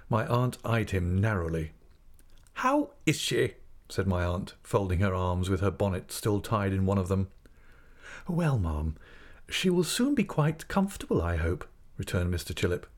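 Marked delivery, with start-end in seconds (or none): 2.5-3.5 high, slow, pointed question; aunt's drawn-out, pitched-up query; demanding aunt. A narrator's reading. Aunt demands news of the mother. 8.3-11.7 high, placating middle, falling at the end; mildly voiced meek doctor. A narrator's reading. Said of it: "in his meekest manner".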